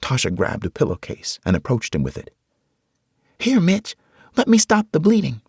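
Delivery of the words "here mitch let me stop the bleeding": put-on high, young girl's voice. Tasha offering to bandage Mitch, high girl's voice. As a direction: voice a scared young girl.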